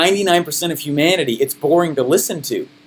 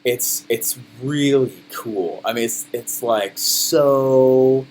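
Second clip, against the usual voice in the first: low, very slow, soft monotone; garbage low-energy delivery; 7 semitones lower, 4 dB quieter, much slower than usual. Retold or imitated. Speaker imitating his earliest podcast episodes, dull low-energy drone. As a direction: drag it out lifelessly with halting ums. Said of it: "My delivery was garbage".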